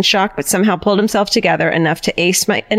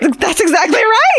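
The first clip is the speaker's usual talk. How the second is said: laughing; 12 semitones higher than usual. Real, not acted. Emotional reaction to ghost twist.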